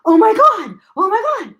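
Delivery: high-pitched, over-the-top mock gushing; emphatic. Shown as a demonstration. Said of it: "Emphatic means you're like".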